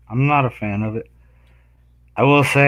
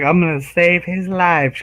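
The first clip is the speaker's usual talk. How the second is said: higher, drawn-out imitation of her; imitated well-meaning girl; 7 semitones higher than usual. Retelling the girl who threw the tortoise, imitated well-meaning voice. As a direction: slowly mimic her earnest rescue plan.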